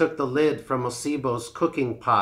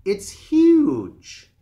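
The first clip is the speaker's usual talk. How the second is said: wide-eyed boast; 9 semitones higher, 8 dB louder than usual. Acted. Tortoise boasts of a huge drum.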